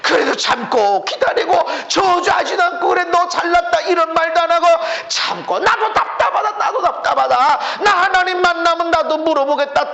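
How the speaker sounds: high, loud sermon at full force; impassioned preaching. Real, not acted. Preacher retelling long-suffering endurance to the congregation, impassioned.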